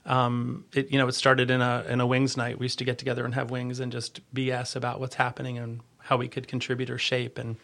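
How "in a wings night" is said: normal talking voice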